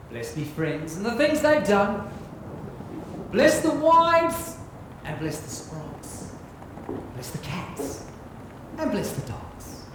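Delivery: solemn blessing poem. Acted. Captain leads the crowd in a blessing, hushed recital. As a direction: bless each one gently like a spell. One last poem, eyes closed.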